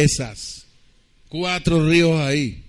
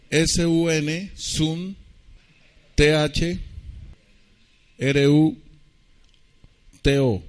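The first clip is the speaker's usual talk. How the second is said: slow letter-by-letter spelling; much slower than usual. Shown as a demonstration. Pastor spelling a Greek word for radio listeners, slow and letter by letter. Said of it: "me toca deletrearles".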